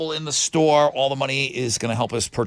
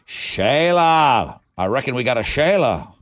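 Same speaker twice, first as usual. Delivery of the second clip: a lightly put-on Australian accent, half-hearted; hammy, half-hearted go at sounding Australian; bad Australian accent; slower than usual. Radio host reading winners' names in a bad Australian accent. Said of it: "that was my bad Australian accent".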